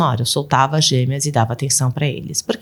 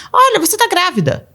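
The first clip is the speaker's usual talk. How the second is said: put-on much higher, a kid's sudden singsong remark; child blurting; 13 semitones higher than usual. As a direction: pop up like a child passing by. (criança falando do nada, bem mais agudo)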